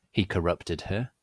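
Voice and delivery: ordinary delivery, nothing marked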